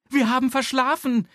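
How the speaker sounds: high, loud hurried mother; panicked mum who overslept; Mama. A narrator's reading. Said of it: "sagt Mama".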